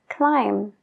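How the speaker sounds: "climb" said as klaim, the b silent. Shown as a demonstration. Teacher shows the silent b in "climb" to learners, clean and slow. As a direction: end "climb" right on the m. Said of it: "no se pronuncia la B".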